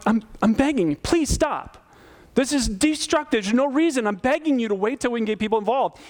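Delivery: high-pitched, urgent retold plea; hurt begging. Pastor begging the accuser to stop, hurt and urgent. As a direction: beg them to stop, voice pushed high. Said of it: "I'm getting louder and more hurt by this".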